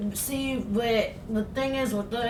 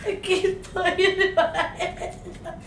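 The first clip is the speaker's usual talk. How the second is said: helpless laughter, higher and louder; laughing; 7 semitones higher, 7 dB louder than usual. Real, not acted. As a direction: laugh through the words. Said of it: "I'm trying to stop".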